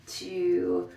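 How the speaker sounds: ordinary delivery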